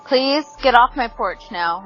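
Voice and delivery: normal talking voice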